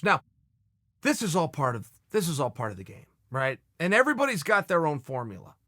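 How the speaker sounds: normal talking voice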